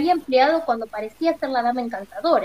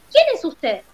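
loud challenging shout, higher; 4 semitones higher, 6 dB louder than usual. A narrator's reading. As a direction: shout the question as if charging with an axe. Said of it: "él gritando".

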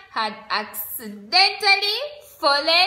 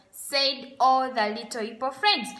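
usual speaking voice

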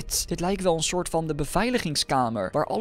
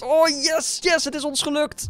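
cheering; 11 semitones higher than usual. Real, not acted. Cheering success.

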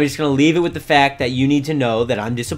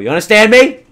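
loud, raised check they understood; stern scolding; 8 semitones higher, 13 dB louder, faster than usual. Pretend. Gamer warning his animals of serious trouble, loud scolding. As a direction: demand an answer, voice jumping up.

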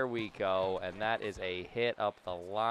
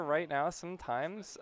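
ordinary delivery

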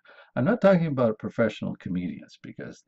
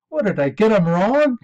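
high, loud, puzzled question; talking dog's punchline; 9 semitones higher, 9 dB louder than usual. Acted. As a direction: deliver the dog's baffled punchline at full volume.

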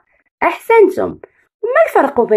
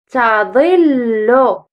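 تظل drawn out very slowly; slow syllable reading; much slower than usual. Shown as a demonstration. Teacher sounds out the word slowly for children. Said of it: "رددوا".

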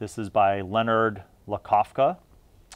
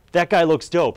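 higher, louder burst of fan enthusiasm; excited reaction; 6 semitones higher, 7 dB louder than usual. Retold or imitated. Gamer spotting cover art for his next game, excited. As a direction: blurt it out like an impressed gamer.

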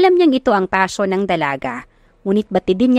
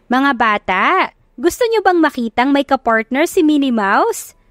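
host na nagtatanong sa mga bata; 5 semitones higher than usual. A narrator's reading. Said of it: "tanong ni Miss Paloma sa mga bata".

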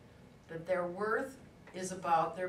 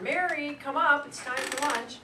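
raised, louder calling-out voice; yelling down; 6 semitones higher, 6 dB louder than usual. Retold or imitated. Woman calls her daughter in for lunch, yelling down. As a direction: sing out higher and louder, as if from an upstairs window. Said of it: "this woman yelled down".

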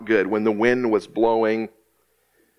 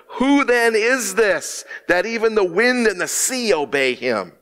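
awed fear; 8 semitones higher than usual. Acted. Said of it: "they became very much afraid".

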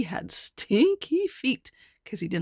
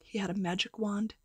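ordinary delivery, nothing marked

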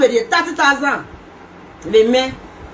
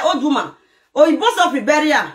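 normal talking voice

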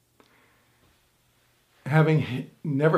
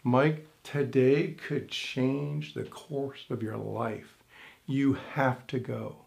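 slow, hushed, lower inner voice; 3 semitones lower, 8 dB quieter, slower than usual. Retold or imitated. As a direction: speak slowly and softly, like a voice inside urging him on. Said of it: "something inside me said".

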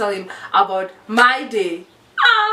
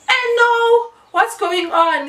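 alarmed; 11 semitones higher, slower than usual. Retold or imitated. Confronting crying friend.

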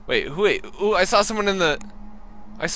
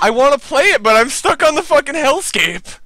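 horror game streamer; 10 semitones higher, 8 dB louder than usual. Real, not acted. Streamer frustrated at stuck game.

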